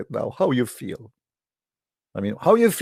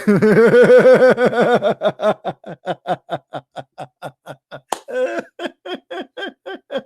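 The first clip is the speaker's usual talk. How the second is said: long real laugh, higher and louder; mocking laugh; 8 semitones higher, 4 dB louder than usual. Real, not acted. Preacher laughing at the quote before the crowd, scornful laugh.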